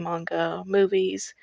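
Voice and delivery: usual speaking voice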